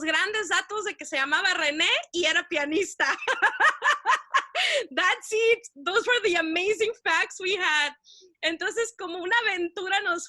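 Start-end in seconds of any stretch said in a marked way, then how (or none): none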